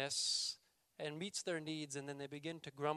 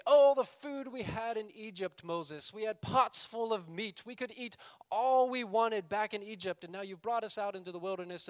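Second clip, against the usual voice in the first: higher, louder mock whine of a grumbling crowd; whiny complaining; 6 semitones higher, 7 dB louder than usual. Israelites grumbling to Moses about the food, loud mock whine. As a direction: moan it like spoiled people missing their meat. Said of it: "then they complain about food".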